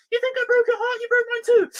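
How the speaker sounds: very high squealed melodrama; absurdly high, hammed-up outburst; overwrought drama quote. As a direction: blurt it in a squeaky mock-heartbroken voice. Mock-quotes cringe show line.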